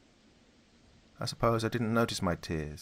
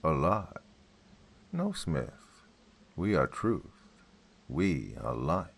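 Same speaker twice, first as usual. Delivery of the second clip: deep monotone; 2 semitones lower, slower than usual. Acted. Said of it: "The voice was deep and monotone".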